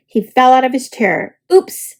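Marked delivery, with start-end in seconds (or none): 1.5-2.0 loud sudden oops; loud startled oops. A narrator's reading. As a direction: yelp as he falls. Falls out of chair.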